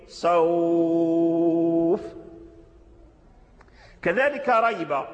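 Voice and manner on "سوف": سوف with the و stretched long in a chanted tone; stopping with a long vowel; long stop. Shown as a demonstration. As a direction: draw out the و in سوف fully, chanting. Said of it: "تقف بالمد الطويل سوف".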